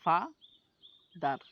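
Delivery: ফা, pause, দার, as two separate beats; syllable by syllable. Shown as a demonstration. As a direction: split ফা and দার with a clear gap. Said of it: "এখানে দুইটা জিনিস".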